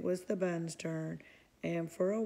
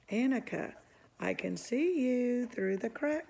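cried out; 5 semitones higher, 4 dB louder than usual. A narrator's reading. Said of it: "cried Pippi".

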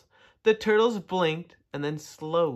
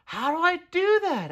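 high-pitched question called down; calling out; 4 dB louder than usual. A narrator's reading. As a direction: call back down in a high voice. Stuck Tudley calls down to turtles.

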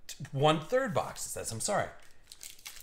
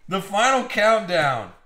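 louder, higher hype call; dramatic announcer; 4 semitones higher, 6 dB louder than usual. Acted. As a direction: announce it like an arena host. Dramatic announcement of last box.

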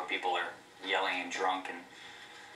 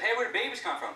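put-on high, innocent child asking; a kid's wide-eyed question to the teacher; 12 semitones higher than usual. Teacher asks the class, kid pipes up high.

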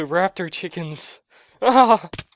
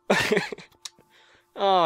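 normal talking voice

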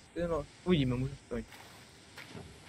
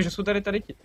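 ordinary delivery

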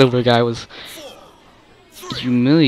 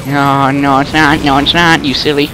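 raised, insistent string of denials; loud protest; 2 semitones higher, 4 dB louder than usual. Real, not acted. Gamer arguing with the game as it turns, loud protest.

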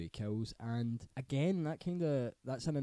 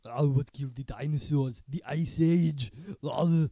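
loud, higher-pitched hammed-up Arnie; Arnie impression; 3 semitones higher, 9 dB louder, slower than usual. Winding up co-host with icy film puns, loud mock Arnie. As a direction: belt out the icy pun as Arnie, drawn out. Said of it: "That's my army voice".

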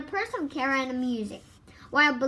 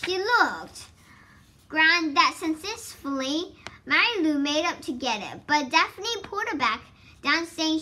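usual speaking voice

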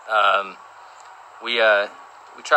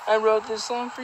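imitating [name]; 10 semitones higher, 3 dB quieter than usual. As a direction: coo it like the songwriter dedicating it to you. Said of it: "Ed's gonna be like".

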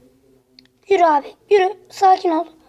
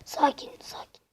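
much softer unvoiced whisper; 12 dB quieter than usual. Real, not acted. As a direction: hush it to yourself. Calming himself during match.

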